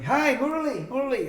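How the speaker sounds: high, loud call of a name; retold shouting. Visitor looking for her at the ashram, retold shouting. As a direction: call out high for someone out of sight. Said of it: "I start shouting".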